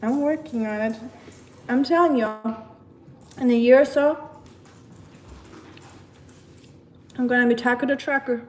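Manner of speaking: hammed-up southern US accent; bad southern accent. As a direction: put on an amateur southern trucker accent. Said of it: "I have the worst southern accent".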